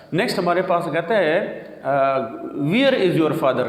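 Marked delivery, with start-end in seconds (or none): 2.6-3.7 Where as "veer"; long "ee" sound in Where; mispronounced where. Shown as a demonstration. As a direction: say Where to rhyme with "here". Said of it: "It's not".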